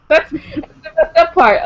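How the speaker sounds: slow, laughing high words. Real, not acted. To her friend about the books, laughing slowly. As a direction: giggle through the words.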